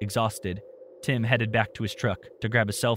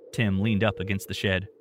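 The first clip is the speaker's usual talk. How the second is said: usual speaking voice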